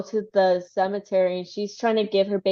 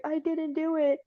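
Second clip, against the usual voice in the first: high, softer denial; small high-pitched protest, lightly acted; mock protest; 8 semitones higher, 6 dB quieter than usual. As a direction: imitate a wife pleading innocence. Voicing the accused wife's denial.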